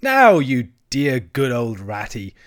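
slow, loud coaxing Toad; imploring. A narrator's reading. Toad begging Ratty to come along, imploring. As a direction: coax him, stretching the endearments. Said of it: "said Toad imploringly".

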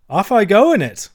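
high, loud, exultant; emphatic. A narrator's reading. Toad announcing his motor escape to friends, exultant. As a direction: launch it gleefully, loud and high. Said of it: "replied Toad emphatically".

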